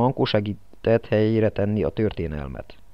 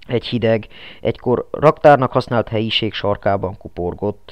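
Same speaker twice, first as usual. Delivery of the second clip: usual speaking voice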